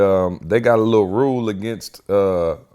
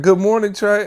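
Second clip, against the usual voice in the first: teachers greeting; 12 semitones higher than usual. Retold or imitated. Teachers voiced.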